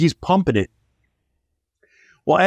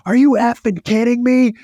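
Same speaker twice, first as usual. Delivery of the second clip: furious owner; 7 semitones higher than usual. Retold or imitated. Car owner cursing at the kid, mock furious. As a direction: imitate a man losing it over his car. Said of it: "He's like".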